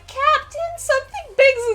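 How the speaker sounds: put-on very high, girlish call; little elf girl. Elf girl lookout alerting the captain, high girlish call. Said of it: "She's like".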